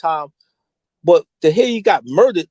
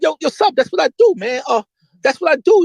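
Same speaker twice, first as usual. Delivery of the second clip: high, carefree bragging; high mock Poe voice; cocky shrug; 7 semitones higher than usual. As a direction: brush off the question proudly. Voicing Poe's reply.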